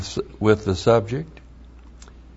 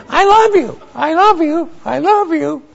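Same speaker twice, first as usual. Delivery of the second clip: very high parrot voice repeating I love you; squeaky mock parrot chant; parrot impression. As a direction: mimic a parrot, pitched way up. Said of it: "I get a damn parrot".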